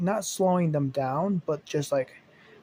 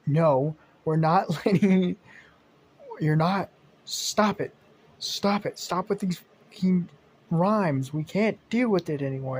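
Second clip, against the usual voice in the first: a raised, exasperated rant; fed up scolding; 4 semitones higher, 3 dB louder than usual. Retold or imitated. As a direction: scold him for his rhymes, fed up. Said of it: "she's like".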